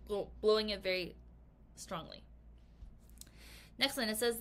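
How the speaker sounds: ordinary delivery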